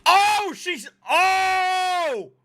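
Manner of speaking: high, drawn-out shocked "oh"; real high-pitched shock; shocked outcry. Real, not acted. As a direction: cry out as the blow lands. Shocked reaction to a slap.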